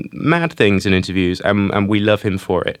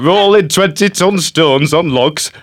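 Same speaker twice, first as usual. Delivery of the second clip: Ridley impression; 6 semitones higher, slower than usual. Host quotes Ridley on the pyramids, in a laughing mock Yorkshire voice. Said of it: "And he was like".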